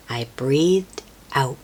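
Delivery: ordinary delivery, nothing marked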